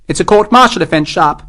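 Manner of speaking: clipped command from a captain; officer's voice. A narrator's reading. Captain reassuring the sergeant, high haughty officer voice. Morris speaking.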